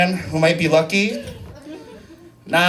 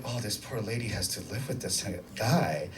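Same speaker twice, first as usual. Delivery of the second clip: very soft, low private thought; pitying aside; 8 semitones lower, 16 dB quieter than usual. Retold or imitated. Comedian quietly pities the driver's wife, in an undertone. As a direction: murmur it low, as if to yourself.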